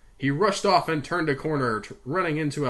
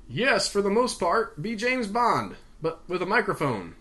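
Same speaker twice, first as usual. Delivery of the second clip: lightly voiced boss; instructing boss; 7 semitones higher than usual. A narrator's reading. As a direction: instruct him with relish. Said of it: "Gavin instructed".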